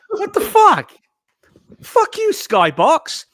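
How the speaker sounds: angry outburst. Real, not acted. Furious at broken Skybox.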